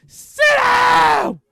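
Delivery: huge high drawn-out bellow; full-force authoritative roar; shouted command. Acted. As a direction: scream the order at the kid. Acts vice president ordering the kid.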